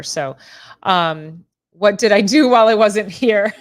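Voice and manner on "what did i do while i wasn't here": loud, laughing through the words; laughing question. Real, not acted. Teasing Kenny about what he missed, laughing. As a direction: crack up while asking.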